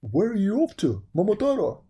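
loud, high barked question; dog voice. A narrator's reading. Dog questioning Momotaro on the road, loud barking voice. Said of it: "says the dog".

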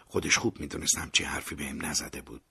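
ordinary delivery

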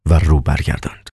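ordinary delivery

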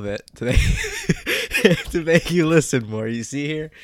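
laughing loudly mid-sentence. Real, not acted. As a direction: giggle through the words. Teasing the listener by stalling.

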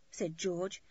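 ordinary delivery, nothing marked

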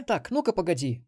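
high female voice, brusque interruption; Мелисса, grumbling. A narrator's reading. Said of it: "буркнула Мелисса". (бурчит высоким женским голосом, перебивая)

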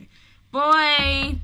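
usual speaking voice

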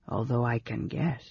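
low and soft, to himself; undertone. A narrator's reading. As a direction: add it privately under your voice. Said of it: "it was in an undertone".

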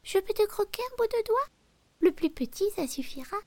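high squeaky mouse voice; twitchy squeaking rat voice. Acted. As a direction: squeak it like a tiny greedy mouse. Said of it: "dit la souris".

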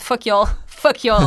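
laughing through a slow jokey curse; slow, laughing mock defiance. Real, not acted.

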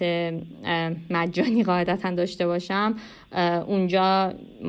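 ordinary talk

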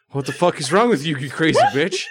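high, fast outburst of outrage; mock scream. A narrator's reading. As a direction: shriek it fast, half laughing. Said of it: "until he screamed again".

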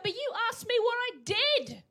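put-on high protesting kid voice; indignant kid. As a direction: protest like a wronged child. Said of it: "I'd be like".

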